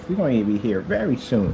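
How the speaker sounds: ordinary talk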